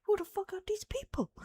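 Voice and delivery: rising high, then dropping to a whisper; mock bewildered, ending in a whisper; baffled whisper-rant. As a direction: hiss out the confusion at the end. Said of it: "It's like".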